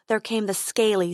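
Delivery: ordinary delivery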